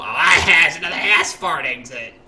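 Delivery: loud, slow, delighted call-out; savouring a silly name loudly; gleeful exclaim. Retold or imitated. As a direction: announce it with childish glee. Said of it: "That's what I said".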